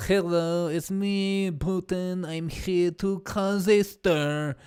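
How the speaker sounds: mock Russian accent as Putin, slow at the end; hammed-up Russian accent pretending to be Putin; fake Russian accent. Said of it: "speak to him with a fake Russian accent".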